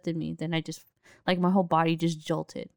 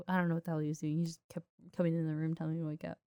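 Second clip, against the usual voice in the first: usual speaking voice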